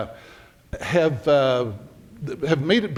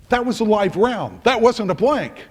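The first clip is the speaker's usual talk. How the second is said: raised, higher protest; indignant complaint; 7 semitones higher, 4 dB louder than usual. Retold or imitated. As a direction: complain it was a real bullet. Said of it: "and he says".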